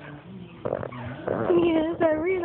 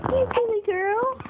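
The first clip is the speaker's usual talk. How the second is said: much higher, sing-song teasing; 9 semitones higher than usual. Real, not acted. Mother teasing baby girl, high cooing baby talk. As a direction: tease her gently up high.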